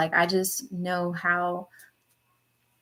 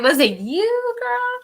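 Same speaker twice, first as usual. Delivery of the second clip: high, louder teasing question; playful callout; 5 dB louder, slower than usual. Retold or imitated. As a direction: call her out with a grin. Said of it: "I'm like".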